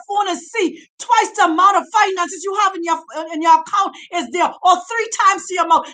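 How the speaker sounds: rising, shouting sermon delivery; preaching. Acted. Preacher urging Bantus to leave, fiery and shouting. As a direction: preach it hard to the congregation. Preacher exhorting congregation at full force.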